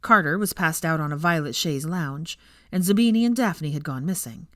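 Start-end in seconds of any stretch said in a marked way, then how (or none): none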